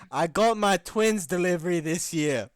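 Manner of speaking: mock his broken English loudly; mock foreign accent. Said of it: "I have to do it in the accent".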